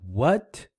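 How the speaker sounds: WHAT gliding up as a question; surprised rising tone. Shown as a demonstration. Teacher introducing the second tone, surprised rising question. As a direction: ask WHAT with the pitch climbing. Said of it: "in a way that you're surprised".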